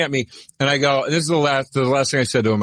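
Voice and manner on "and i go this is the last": normal talking voice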